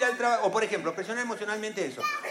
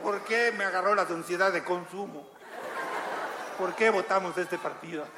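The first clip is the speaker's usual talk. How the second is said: madre culpable; 2 semitones lower, 3 dB quieter than usual. Pretend. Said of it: "la mamá se va con una lanza clavada".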